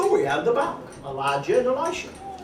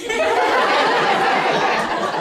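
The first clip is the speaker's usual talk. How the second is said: a burst of airy surprised laughter; real laugh. Real, not acted. Laughs at child interrupting.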